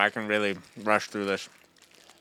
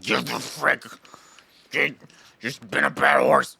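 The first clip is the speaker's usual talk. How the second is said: put-on voice, rising to a loud finish; mock scolding; 4 dB louder than usual. Scolding a misbehaving horse, mock angry outburst. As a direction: burst out in mock anger, breaking off midway.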